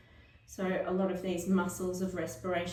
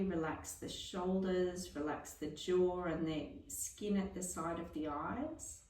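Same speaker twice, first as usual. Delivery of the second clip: softer, fading guided relaxation voice; 6 dB quieter than usual. Acted. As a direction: talk them gently into stillness. Guided breath-hold relaxation.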